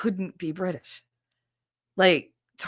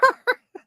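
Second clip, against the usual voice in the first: high burst of laughter; big laugh; 4 dB louder than usual. Real, not acted. Streamer bursting out laughing at chat. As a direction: crack up at the comment.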